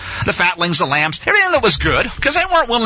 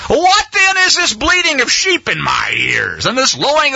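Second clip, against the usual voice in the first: accusing prophet; 6 semitones higher than usual. Acted. Samuel confronts Saul.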